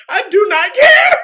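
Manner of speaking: high, loud mock wail; hammed-up defiant shout; retold defiant cry. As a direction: shout it as an imitation, near tears laughing. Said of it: "She was like".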